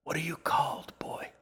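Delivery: put-on old woman's whispered question. A narrator's reading. Old woman asks the boy his name, whispering. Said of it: "Lima whispered".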